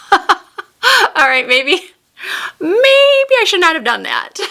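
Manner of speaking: high giggly voice breaking into laughter; laughing. Real, not acted. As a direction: talk through real giggles. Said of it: "don't know why I can't stop laughing".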